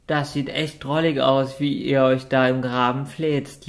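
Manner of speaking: ordinary delivery, nothing marked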